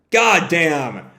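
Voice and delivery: ordinary delivery, nothing marked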